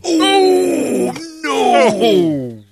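high, loud shocked shriek; shocked cry. Real, not acted. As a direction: cry out in horror. Reaction to scissors story.

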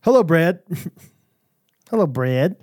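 mock British greeting "Hello, Brad"; lightly hammed British hello; British accent. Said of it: "recovering from the British accent".

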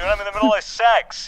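ordinary delivery

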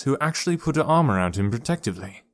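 ordinary delivery

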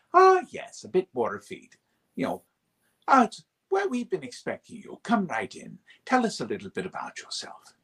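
high, airy, effete welcome; effete Breton. Shown as a demonstration. Actor demoing the effete Breton voice. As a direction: sound like a prim, refined host. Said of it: "who I created with that voice".

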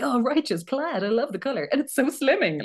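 a laid-back surfer dude admiring the kilt; chill drummer. Retold or imitated. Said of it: "and he's like".

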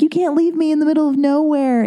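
mock-petulant plea not to be left; whiny protest. To her boyfriend leaving for the hotel, mock-whiny protest. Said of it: "and I'm like".

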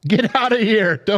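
high-pitched bantering send-off; mock dismissal. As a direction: shoo the guest away in pretend annoyance. Host kicks guest off the show.

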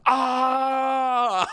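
high, loud hammed-up scream; mock screaming. Dazed classmate waking up screaming at the teacher, mock loud. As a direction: scream like you just woke up terrified. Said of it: "This is screaming".

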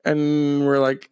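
ordinary delivery

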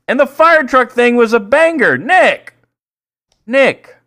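high, emphatic shout at a friend; insistent calling out. Real, not acted. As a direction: demand his attention, indignant. Calling out inattentive co-host.

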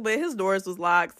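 ordinary delivery

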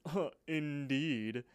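slow, drawn-out posh huh, indeed; fancy person voice. Shown as a demonstration. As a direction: demo your snooty fancy-person line. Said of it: "I always say the words".

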